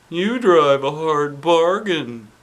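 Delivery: high, slow resigned principal voice; sighing principal. A narrator's reading. Principal conceding to the kids with a sigh. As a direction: give in with a sigh. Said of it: "Mister Klutz sighed".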